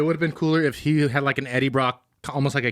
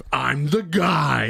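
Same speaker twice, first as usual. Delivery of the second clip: smug reveal; much slower than usual. Retold or imitated. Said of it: "You know what's even crazier".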